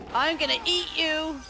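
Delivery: high, loud mock threat. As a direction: yell it like a pouncing monster. Playful threat repeated louder, higher.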